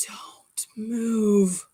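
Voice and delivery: whispered warning, breath only; urgent whisper. Retold or imitated. Husband whispering a warning to his wife about the spider. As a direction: hush her so the spider stays put. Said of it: "He goes".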